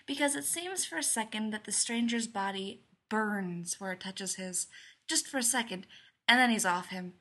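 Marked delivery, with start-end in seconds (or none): none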